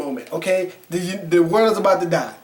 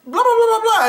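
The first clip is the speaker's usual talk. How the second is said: much higher, hammed-up babble; mock blather; 12 semitones higher than usual. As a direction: imitate her speech as high nonsense. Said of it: "And so she was like".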